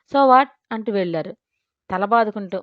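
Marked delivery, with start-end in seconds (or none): 0.1-0.5 higher, louder playful so what; teasing echo. Acted. Husband teasing his wife, playful echo. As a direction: tease the wife by echoing the daughter. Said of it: "Ravindra Hema బొగ్గ లాగి".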